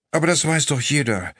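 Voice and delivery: drowsy wife voice; sleepy. A narrator's reading. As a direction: say it half-asleep. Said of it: "sagte Emma schläfrig".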